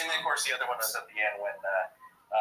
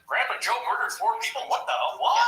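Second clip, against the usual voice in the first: shocked reaction; 11 semitones higher than usual. Retold or imitated. Reacting to shocking letter news.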